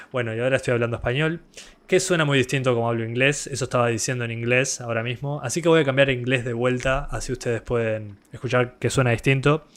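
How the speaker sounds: relaxed rapid Spanish delivery. Shown as a demonstration. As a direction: slip into fast, easygoing Spanish. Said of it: "say some stuff in Spanish".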